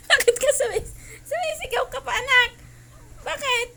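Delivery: high, loud words through laughter; laughing exclamation. Real, not acted. Owner asking the dog why it shouts, high and laughing. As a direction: ask it loudly while cracking up.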